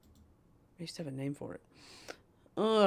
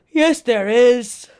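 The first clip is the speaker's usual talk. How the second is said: slow, louder, higher repeat; loud slow insistence; 6 semitones higher, 13 dB louder, slower than usual. Shown as a demonstration. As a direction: drag it out and insist. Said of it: "repeats it loud and slow".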